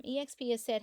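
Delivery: normal talking voice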